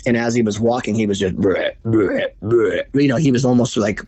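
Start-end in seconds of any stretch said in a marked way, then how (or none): 1.3-2.8 short buck grunts, spaced out; mimicked deer grunting in a run; imitated buck grunt. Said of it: "he was, like, walking and grunting".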